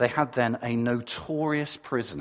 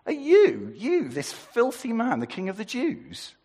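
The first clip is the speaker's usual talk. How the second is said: high, scornful, louder disbelief; incredulous sneer; 11 semitones higher, 3 dB louder than usual. Shown as a demonstration. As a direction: scoff at the filthy prisoner. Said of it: "Or maybe it's".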